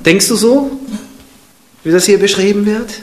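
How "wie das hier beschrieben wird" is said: usual speaking voice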